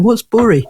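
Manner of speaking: a questioning echo of northern "bury", rhyming with "hurry"; "bury" queried as an unfamiliar word; puzzled kids echoing "bury". Retold or imitated. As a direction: imitate puzzled kids repeating "bury" as "burry". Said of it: "kids are looking at us going".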